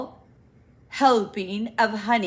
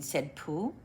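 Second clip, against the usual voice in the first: plain reading voice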